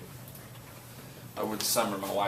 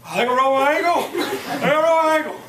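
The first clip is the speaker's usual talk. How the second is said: laughing; 9 dB louder, faster than usual. Retold or imitated. Retelling own reply while laughing.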